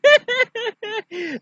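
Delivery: very high, loud doll cackle; hammed-up villain laugh; mocking cackle. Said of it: "He's going like".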